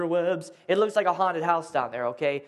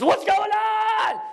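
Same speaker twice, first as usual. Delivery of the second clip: drawn-out alarmed shout; 12 semitones higher, much slower than usual. Retold or imitated. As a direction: drag the shout out slowly. Husband bursts in with machete.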